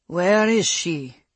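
slow, loud, gruff demand; gruff and forceful, drawn out. A narrator's reading. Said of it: "unable to keep the gruffness from his voice".

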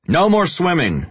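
loud high yell across the beach. Acted. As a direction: call it out loud to officers. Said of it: "I yell over to the other officers".

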